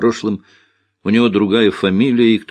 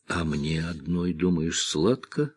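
sighing; 2 semitones lower, 8 dB quieter than usual. A narrator's reading. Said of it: "вздохнула она".

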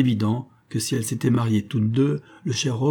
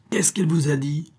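a hot, higher furious question; furious cry; 6 semitones higher than usual. A narrator's reading. Old man furiously demanding answers from the detective, angry higher cry. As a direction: demand it, pitched higher in rage. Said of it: "cria le vieillard furieux".

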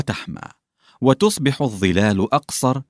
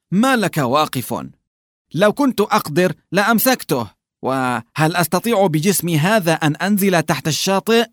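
mocking mimicry; 7 semitones higher than usual. A narrator's reading. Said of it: "فيقلده جيراسيم مشاكسا".

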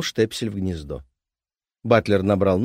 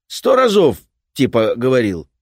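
gruff repairman; 4 semitones higher, 8 dB louder than usual. Acted. Repairman insists.

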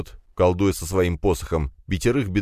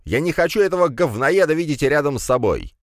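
high-pitched indignant rant; angry cry; 9 semitones higher than usual. A narrator's reading. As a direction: spit out disgust in a high voice. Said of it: "вскрикнул граф".

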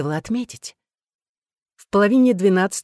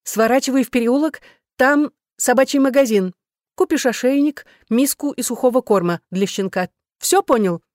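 higher, insistent orders; commanding; 6 semitones higher, 4 dB louder than usual. A narrator's reading. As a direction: lay down the law to a husband. Wife ordering husband about. (повелительно, с напором)

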